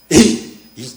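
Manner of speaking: loud, sudden imitated cry of irritation; explosive mock-angry "hey"; angry outburst. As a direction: act out snapping at someone who grabbed you. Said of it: "화가 나가지고".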